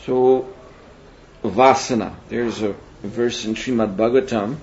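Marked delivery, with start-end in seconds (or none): none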